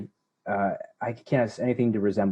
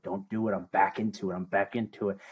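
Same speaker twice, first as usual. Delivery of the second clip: softer, low inner voice rising up; a lightly voiced inner New Yorker creeping back; 4 semitones lower, 7 dB quieter than usual. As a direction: mutter to yourself as the old anger returns. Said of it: "feel that voice creeping up".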